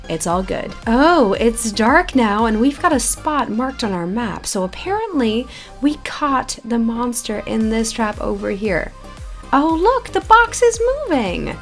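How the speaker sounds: normal talking voice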